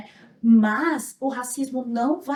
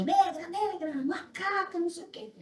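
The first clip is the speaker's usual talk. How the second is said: high, hushed mock yell; retold taunting shout; 5 semitones higher, 6 dB quieter than usual. Girl retelling boys cornering her at school, softly mimicked yell. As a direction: imitate chanted jeers without the volume.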